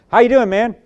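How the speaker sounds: loud, high friendly hail; hearty greeting. Retold or imitated. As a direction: call out a cheery hello across the room. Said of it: "I shake Tashawn's".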